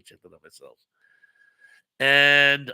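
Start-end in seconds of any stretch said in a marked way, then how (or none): 0.0-0.8 much softer, low aside; muttering to self. Real, not acted. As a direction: mutter it under your breath.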